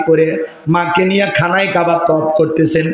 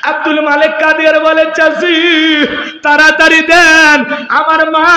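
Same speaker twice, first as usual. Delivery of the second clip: urgent preaching; 10 semitones higher, 4 dB louder than usual. Acted. Son urging the aunt for his mother's letter, loud high cry.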